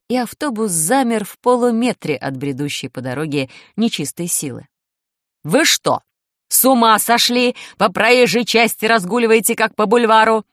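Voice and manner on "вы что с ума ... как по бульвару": loud, angry driver yelling; shouting driver. A narrator's reading. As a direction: yell at them from the bus. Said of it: "закричал шофёр автобуса".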